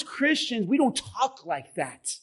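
ordinary talk, nothing marked

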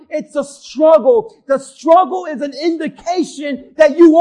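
loud preacher's cry, building to a shout; full-force preaching. Acted. Pastor urging the church, loud preaching shout. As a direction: drive it home to the congregation.

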